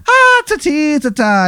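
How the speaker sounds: very high mock-Irish lilt; sing-song nonsense in a terrible Irish accent; Easy Irish. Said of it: "this terrible Irish accent".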